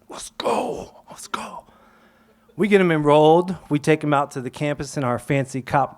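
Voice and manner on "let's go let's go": breathless whispered let's go; excited whisper. Retold or imitated. Teacher celebrating under his breath, whispered. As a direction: hush it out like a secret celebration.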